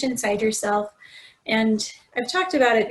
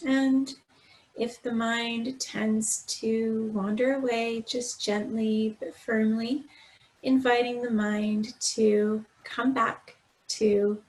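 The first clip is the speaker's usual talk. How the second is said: guided meditation; 4 dB quieter than usual. Acted. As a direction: gently guide them through breath and body, unhurried. Leads a body scan meditation.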